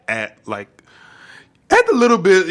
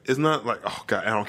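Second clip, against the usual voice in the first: ordinary delivery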